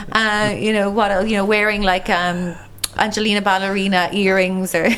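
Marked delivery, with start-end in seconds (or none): none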